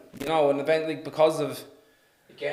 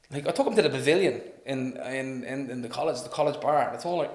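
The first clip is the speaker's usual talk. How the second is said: ordinary delivery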